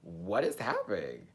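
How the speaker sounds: low, hushed bewilderment; soft, low re-enacted shock; stunned reaction. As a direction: murmur it, taken aback. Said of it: "I just was like".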